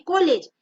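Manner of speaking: कॉलेज read as "ko-lej"; कॉलेज with o as written; spelling pronunciation. Shown as a demonstration. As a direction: say कॉलेज with a closed long o. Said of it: "ओ है तो हमको पढ़ना क्या चाहिए".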